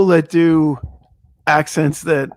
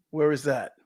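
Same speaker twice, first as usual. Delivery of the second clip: ordinary talk, nothing marked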